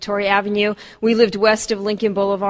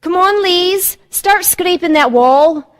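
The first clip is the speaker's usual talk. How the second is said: higher, louder Glaswegian mother, slow on the order; Scottish mom; 8 semitones higher, 7 dB louder, much slower than usual. Retold or imitated. Daughter imitating her Scottish mother ordering her to scrape walls, Glasgow accent. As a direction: mimic a Glasgow mum giving orders. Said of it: "she would just, um, say".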